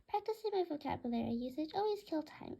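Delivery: ordinary delivery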